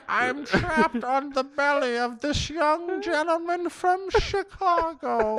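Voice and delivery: high, slow, hammed-up villain rasp; belly begging for freedom like a cartoon villain; Skeletor-like stomach voice. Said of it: "Why does he sound like Skeletor?".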